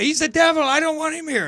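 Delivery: high, loud panicked protest; mock freak-out. Singer telling manager to remove him, high panic. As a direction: imitate someone badly alarmed. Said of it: "she really freaked out".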